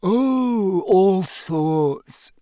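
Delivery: drawn-out, wailing ghost voice; whiny Myrtle-style ghost; moping ghost girl.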